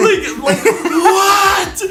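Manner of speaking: ordinary delivery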